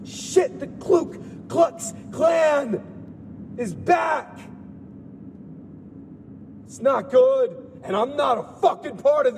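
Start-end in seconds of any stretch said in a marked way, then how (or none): 0.0-4.4 alarmed outburst. Real, not acted.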